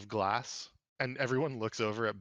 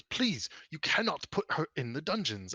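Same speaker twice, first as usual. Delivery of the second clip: higher, drawn-out earnest plea; pleading father; 6 semitones higher than usual. Acted. Father begs as the three are waved out, pleading. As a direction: implore them, stretching the key words.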